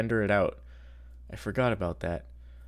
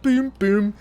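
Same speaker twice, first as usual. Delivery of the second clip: imitating GPS ding; 11 semitones higher, 5 dB louder than usual. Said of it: "this had the ding".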